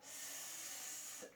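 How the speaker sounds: a long voiceless sss; hissing s. Shown as a demonstration. Teacher to children, hissing the letter S. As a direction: hiss the S like a snake. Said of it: "avez-vous écrit".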